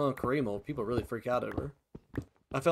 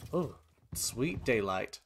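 ordinary talk

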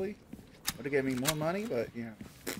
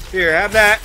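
loud taunt; 5 semitones higher, 10 dB louder than usual. Real, not acted. Firing at enemy.

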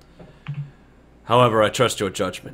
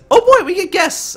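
very high, loud squeal of delight; excited little girl; 7 dB louder than usual. Acted. Little girl thrilled at having guests, squealing high. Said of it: "gets super excited".

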